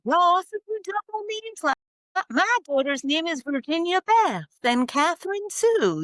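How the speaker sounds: high, sing-song Southern imitation; teasing Southern accent impression. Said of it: "I'm so good at the accent".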